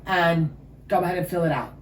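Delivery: mock-chirpy front desk voice; bubbly receptionist. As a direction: hand over the clipboard like serving ice cream. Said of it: "And he goes".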